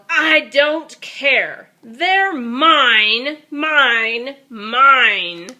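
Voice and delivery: loud, slow stubborn yell. Acted. Greedy dinosaur yelling back at friends, stubborn and loud. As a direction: shout it, digging in. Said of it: "the Minosaur yelled".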